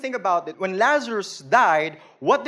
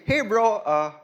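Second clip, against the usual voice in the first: saying it to a bro; 3 semitones higher, 4 dB louder than usual. Shown as a demonstration. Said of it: "You can actually say".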